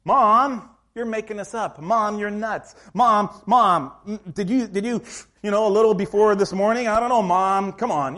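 mock teen son pleading; son scolding mom. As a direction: whine at your mother in disbelief. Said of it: "One of the disciples is James".

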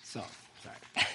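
breath catching, barely voiced apology; tears rising as the poem ends; choked up. Real, not acted. Apologising to the congregation while choking up, tearful whisper. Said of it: "I feel it welling up now".

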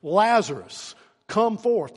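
calling out to a dead man; a strong loud summons; preacher calling Lazarus out. Retold or imitated. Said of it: "he cried out with a loud voice".

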